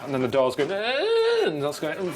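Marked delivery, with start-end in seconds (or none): 0.7-1.4 rising high creak imitation; drawn-out squeal sliding upward; imitated door noise. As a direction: mimic a door with a climbing "eh".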